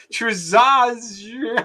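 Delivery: "Trzaz" attempted high, wrestling with the consonants; struggling Polish pronunciation. Real, not acted. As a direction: have a clumsy, high-pitched go at "Trzaz". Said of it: "I can't pronounce any of this".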